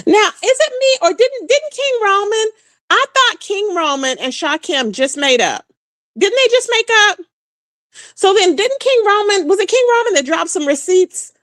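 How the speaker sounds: high, puzzled questioning; confused. Real, not acted. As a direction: ask it in genuine confusion.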